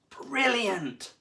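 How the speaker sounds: thrilled at the sight; an eager young shark's delight; excited. A narrator's reading. Sammy delighted by the game.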